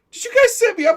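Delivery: very high, loud, hammed-up hurt; mock betrayed. [name] realizing friends pranked him, mock betrayed squeal.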